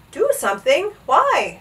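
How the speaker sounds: high, smiling puzzled question. A narrator's reading. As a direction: ask lightly, lifting the pitch at the end. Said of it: "smiled the captain".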